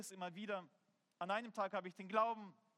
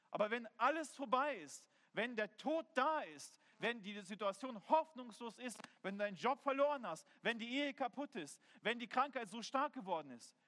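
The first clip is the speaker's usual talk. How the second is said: raised, driving sermon list; higher-pitched, emphatic preacher's voice; impassioned preaching; 3 semitones higher, 3 dB louder than usual. Real, not acted. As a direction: hammer out each hardship to the congregation. Preaches a mounting list of hardships.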